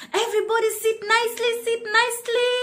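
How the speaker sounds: high, quick singsong prompt; cheery reminder. Real, not acted. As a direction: coax the class to settle. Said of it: "teacher urging children".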